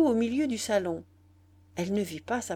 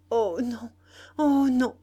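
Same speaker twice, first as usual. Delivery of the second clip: distressed murmur rising to a louder cry; faltering plea; 3 semitones higher, 6 dB louder than usual. A narrator's reading. The boy implores Nana not to go, stammering. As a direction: beg tearfully, the second time higher.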